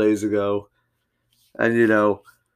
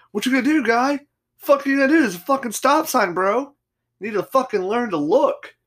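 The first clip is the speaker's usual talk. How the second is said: mock yelling taunt; 12 semitones higher than usual. Speaker taunting the driver who ran the stop sign, high mock yell. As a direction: squeal it up high like a cocky taunt.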